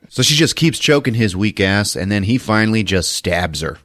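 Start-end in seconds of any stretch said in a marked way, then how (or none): none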